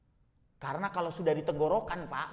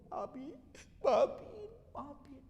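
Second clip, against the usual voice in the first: tearful apology; 12 semitones higher, slower than usual. Acted. Estranged child asking dying father's forgiveness, acted weeping. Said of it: "Air mata nggak berhenti".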